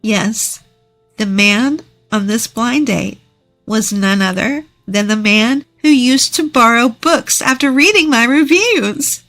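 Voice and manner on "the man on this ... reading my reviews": usual speaking voice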